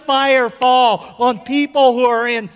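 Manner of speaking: building to a high, full-force shout; impassioned prayer cry. Real, not acted. Preacher pleading with God for his people, impassioned shouted prayer.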